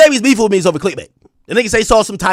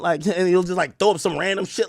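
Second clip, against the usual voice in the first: ordinary talk, nothing marked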